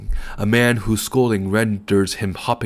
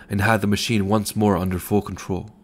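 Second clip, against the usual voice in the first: plain reading voice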